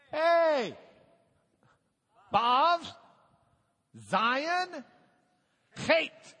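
calling out each Hebrew letter high and loud; loud quizzing. Real, not acted. Teacher quizzing the class on Hebrew letters, loud and high. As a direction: boom the letter names out to the room.

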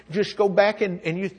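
ordinary talk, nothing marked

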